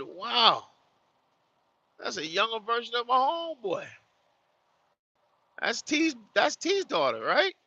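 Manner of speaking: amazed recognition. Retold or imitated. Telling a friend about seeing the little girl's photo, amazed. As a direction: blurt it out in amazement.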